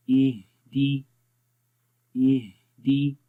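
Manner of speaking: slow इहदी, the ह breathy with air flowing; recitation demo. Shown as a demonstration. Teacher shows students breathy ह in इहदी. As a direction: stretch इहदी letting breath run through the ह. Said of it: "हा में देखें आवाज़ की सांस कैसे जारी हो रहा है".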